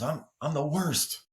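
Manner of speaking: put-on upset kid. Kid to his coach after a missed pop fly, mock dejected. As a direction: lightly voice a kid putting himself down, slowly. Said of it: "A kid missed a pop fly".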